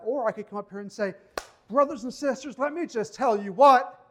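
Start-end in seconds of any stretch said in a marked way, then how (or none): 1.7-4.0 loud, higher-pitched Southern pulpit showman; put-on Southern preacher. Pastor demonstrating pretense to his church, mock Southern preacher. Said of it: "I don't know why I sound Southern".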